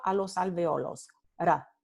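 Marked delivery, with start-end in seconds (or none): 1.4-1.7 single tap of the tongue on Ra; Ra with one quick tongue tap; tapped r. Shown as a demonstration. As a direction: demo of the tapped r. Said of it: "se llama una vibrante simple".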